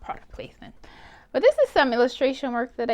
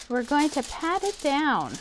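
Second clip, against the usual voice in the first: ordinary talk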